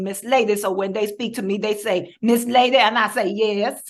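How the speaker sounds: emphatic, self-assured character voice in dialect; Miss Lady. Acted. Character telling subway riders to show respect, proud dialect voice. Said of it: "in the case of Miss Lady".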